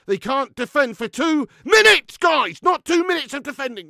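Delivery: loud, high, incredulous complaint; exasperated shouting. Real, not acted. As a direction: raise your voice in despair at the defending. Despairs at two-minute defending.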